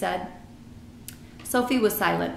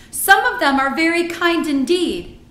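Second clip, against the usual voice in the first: higher, loud and indignant; angry insistence; 9 semitones higher, 9 dB louder than usual. A narrator's reading. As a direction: argue back with heated emphasis. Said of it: "Sophie cried angrily".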